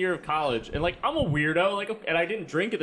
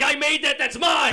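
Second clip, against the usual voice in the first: proud dad shout; 10 semitones higher, 5 dB louder than usual. Retold or imitated. Voicing Brooke's dad.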